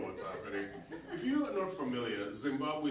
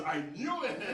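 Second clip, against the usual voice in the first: triumphant exclamation; 9 semitones higher, 3 dB louder than usual. Retold or imitated. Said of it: "And she goes".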